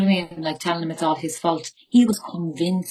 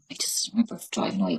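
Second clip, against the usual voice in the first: whisper; 9 semitones lower, 6 dB quieter, faster than usual. Real, not acted. Whispered under emotion.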